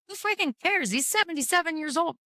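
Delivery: high-pitched dismissive retort; retold exasperation. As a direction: quote yourself fed up, pitch way up.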